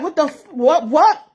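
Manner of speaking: shocked, rising mock outrage; imitating a shocked mom; mom's shocked reaction. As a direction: play the mom's loud disbelief. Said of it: "she like".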